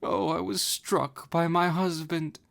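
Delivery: high, slow tearful lament; self-pitying wail. Acted. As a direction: moan it out, sorry for herself. Ozma laments.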